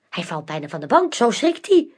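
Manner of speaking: plain reading voice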